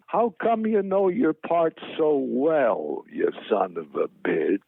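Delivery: drawn-out, high-pitched start sinking to the insult; slow impression, falling away at the end; Jack Benny. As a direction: imitate a comedian's slow mock-indignant compliment. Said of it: "That was the way he spoke".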